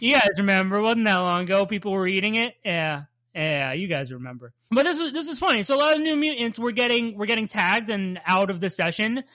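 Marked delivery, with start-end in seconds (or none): none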